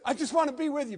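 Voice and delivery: high, earnest plea; healed man begging to stay with Jesus; pleading healed man. Retold or imitated. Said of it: "And he says".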